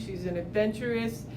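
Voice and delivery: ordinary talk, nothing marked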